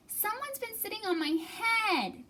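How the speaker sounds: high grumpy little bear; grumbling baby bear. Acted. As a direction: grumble it like a sulky cub. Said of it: "grumbled Baby Bear".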